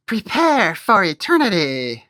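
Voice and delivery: high, slow witch threat; Wicked Witch. Acted. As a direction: drawl it out like a menacing witch. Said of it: "I made her sound like the Wicked Witch".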